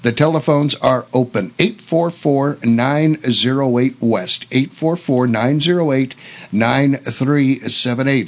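normal talking voice

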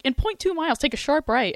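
imitating GPS voice. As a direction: play the GPS announcing a sharp turn. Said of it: "and it's like".

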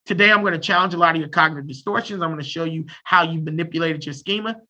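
low, brisk clinical patter, mildly acted; lightly voiced clinician talking jargon; clinical therapist. Shown as a demonstration. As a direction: recite it like a textbook therapist, soft and low. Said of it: "trying to play the clinical game".

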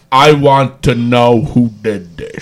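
slow low robotic monotone; flat robot voice, drawn out. Retold or imitated. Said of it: "talking in his robotic voice".

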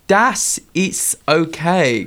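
put-on slow, drawn-out German accent on das ist okay. Reading out a German professor's line, slow German accent. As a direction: read it as a German would say it. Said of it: "Can you do a German accent?".

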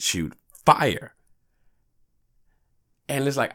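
ordinary talk

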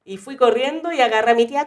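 ordinary talk, nothing marked

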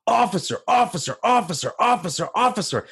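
half-voiced retold scream; mock screaming. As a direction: imitate a man calling frantically for a guard. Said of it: "screaming at the top of his lungs".

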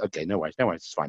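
murmured no worries; soft retreating monk. Retold or imitated. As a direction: let him off the hook quietly. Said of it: "he walked past me".

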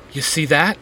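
plain reading voice

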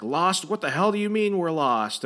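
retold indignant outburst; mock yelling. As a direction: act out an exasperated passenger yelling. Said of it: "she's yelling at what looks like".

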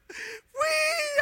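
high, tearful cry. Retold or imitated. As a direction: cry it out like a guilty child. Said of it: "Nunca olvido eso". (grito agudo entre lágrimas)

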